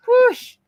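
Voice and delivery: high loud whoosh; whoosh sound effect. Acted. Describing how fast this time of year goes, high loud whoosh. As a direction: sweep it past like the wind.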